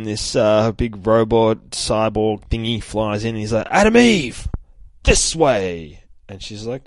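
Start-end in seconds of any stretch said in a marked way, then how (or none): 3.7-6.0 loud, high urgent call. Acted. Cyborg flies in calling Eve over, loud call. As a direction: holler it across the sky.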